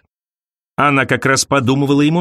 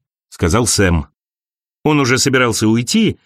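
plain reading voice

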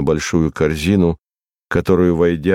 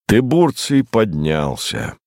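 plain reading voice

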